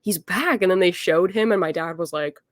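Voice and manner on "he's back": high, loud burst that settles; thrilled cry of recognition; fangirl excitement. Retold or imitated. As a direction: blurt it out to your startled dad. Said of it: "I screamed".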